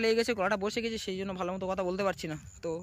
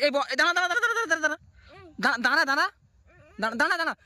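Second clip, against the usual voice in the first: high, rapid repeated stop; urgent high stop call; 6 semitones higher, faster than usual. Real, not acted. Urgently stopping the child. (উঁচু গলায় থামতে বলা)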